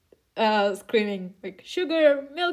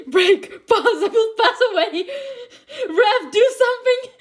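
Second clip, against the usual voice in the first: much higher, louder laughing shrieks; overwhelmed shrieking; 10 semitones higher, 5 dB louder than usual. Real, not acted. Reader shrieks to Rev over the page, laughing and much louder. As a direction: lose it at the comic page.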